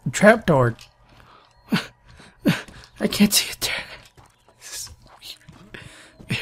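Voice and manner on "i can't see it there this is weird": hushed whisper. Real, not acted. Player searching for the trapdoor, whispering. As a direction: keep it secret, barely a breath.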